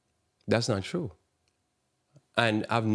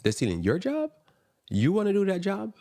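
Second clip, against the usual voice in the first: pitched way up, daring them; incredulous challenge; 11 semitones higher than usual. Real, not acted. Preacher daring the job complainers, high scornful challenge.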